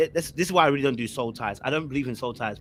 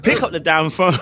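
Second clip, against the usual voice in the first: laughing; 5 semitones higher, 9 dB louder than usual. Retold or imitated. Retold angry demand, laughing.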